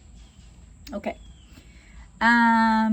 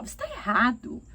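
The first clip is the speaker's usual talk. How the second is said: aggressive. Shown as a demonstration. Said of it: "Ao invés de falar".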